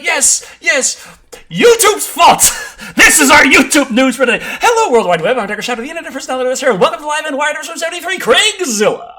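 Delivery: loud, high hyped-up anchor voice; news host intro. Pretend. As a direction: announce it like a shouting TV host. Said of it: "Decker is like".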